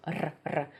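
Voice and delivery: short, crisp tongue-tip trill on "Р, р"; sharp rolled R. Shown as a demonstration. Teacher showing learners a sharper R, short rolled trill. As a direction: roll the "р" sharply. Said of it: "it should be sharper".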